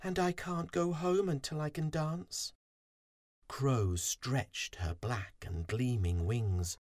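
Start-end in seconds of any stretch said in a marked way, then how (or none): 0.0-2.5 high, small, sorry voice; high-pitched, forlorn little bee; moaning little bee. A narrator's reading. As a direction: lament it as a dejected tiny bee. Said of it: "poor Fuzzy moaned".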